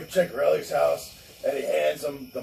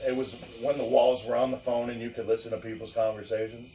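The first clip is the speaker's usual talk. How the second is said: ordinary talk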